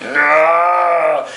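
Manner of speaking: long mock bellow; sustained, hammed-up creature yell; monster cry of pain. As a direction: wail like a giant beast hit in the face. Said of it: "sending him going".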